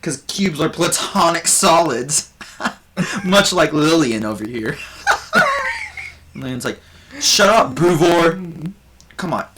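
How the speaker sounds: Buvor working the crowd as a nervous comedian; bard doing stand-up. Acted. Bard telling more jokes.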